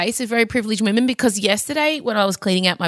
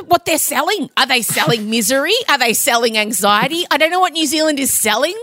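ordinary talk, nothing marked

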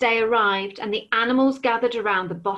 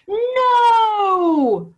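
huge, much higher, louder roared No; roaring dragon; 12 semitones higher, 8 dB louder than usual. Acted. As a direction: bellow it in fury. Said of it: "roared the dragon".

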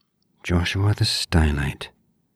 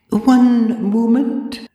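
prim librarian voice; 7 dB louder, much slower than usual. A narrator's reading. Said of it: "raising a withered hand".

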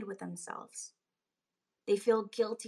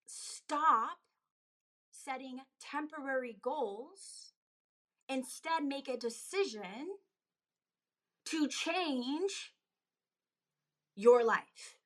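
yelling; 3 semitones higher, much slower than usual. Real, not acted. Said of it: "I wanted to hop on to yell".

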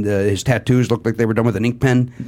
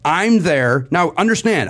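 normal talking voice

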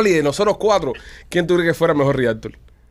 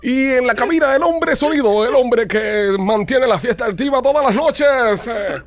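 hyped radio announcer; 8 semitones higher, slower than usual. Acted. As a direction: build up the DJ like a party host.

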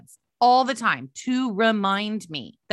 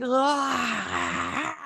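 a thin, whiny cry of Ah; annoying wail. Shown as a demonstration. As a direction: make the most annoying sound you can. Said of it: "Did you hear that?".

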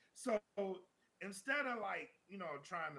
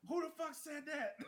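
angry mob yell; 9 semitones higher than usual. Retold or imitated. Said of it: "banging on the doors".

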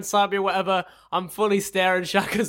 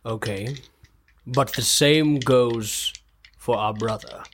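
stern warning; 10 semitones lower than usual. Acted. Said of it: "look him in the eye and say".

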